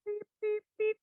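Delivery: high playful beep beep sound; mock beeping. Host searching for her pointer, playful high beeps. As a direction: chirp it like a little machine beeping.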